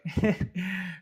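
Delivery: quick, high laugh at the absurd button; short laugh. Real, not acted. Laugh after the complaint.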